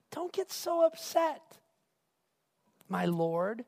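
buttering up. Acted. Aaron replies to an angry Moses, buttering him up. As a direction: soothe and flatter an angry superior. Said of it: "he's buttering him up".

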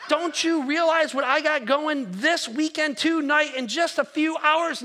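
hammed-up self-important preacher, laughing at himself; indignant inner rant. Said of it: "And I'm thinking to myself".

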